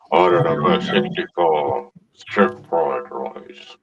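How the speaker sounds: very low, slow voice changer; deep voice. Pretend. Voice changer order call.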